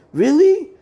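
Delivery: high, loud disbelieving question; high surprised cry; incredulous. Retold or imitated. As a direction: squeak out the disbelief. Class surprised by code names.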